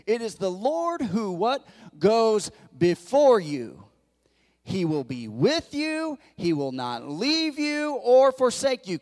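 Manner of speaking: scripture declaimed in slow, ringing phrases; emphatic preaching. Real, not acted.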